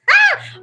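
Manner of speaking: short, very high burst of laughter; sudden laugh. Real, not acted. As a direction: let out one loud, high laugh. Laughs while telling her story.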